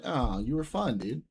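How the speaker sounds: ordinary delivery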